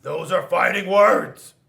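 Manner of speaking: loud challenge. Acted. Rock challenges Paper, loud menacing declaration. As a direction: declare it like a tough guy.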